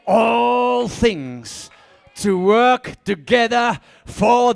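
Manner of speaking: loud, ringing pulpit proclamation; preaching at full force. Acted. Preacher to the church, loud and fervent. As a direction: drive it home to the congregation.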